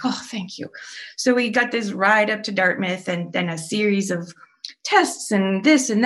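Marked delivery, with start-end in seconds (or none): 0.1-0.7 soft, breathless whisper of relief; relieved whisper. Retold or imitated. Mother thanking nurse after baby is stabilized, whispered. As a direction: barely voice the thanks, drained. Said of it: "whisper heard on lines".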